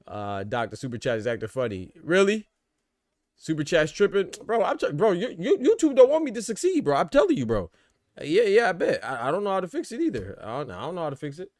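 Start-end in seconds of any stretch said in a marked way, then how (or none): 2.0-10.0 higher and louder, laughing at YouTube; a real flare of outrage at the tech; exasperated disbelief. Real, not acted. Streamer griping to chat that Super Chat broke, high and loud.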